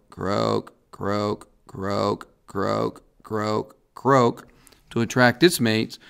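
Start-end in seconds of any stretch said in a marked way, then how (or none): 0.1-4.5 each croak said slowly with a pause between, softly; slow evenly spaced croaks; imitating a slow frog call. Shown as a demonstration. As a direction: spell out a measured croak, one at a time. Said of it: "So this species goes croak".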